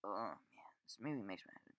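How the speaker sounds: real, soft whisper to himself. Real, not acted. Reviewer quietly confessing his fondness for the film, whispered. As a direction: breathe it out under your breath, barely voiced.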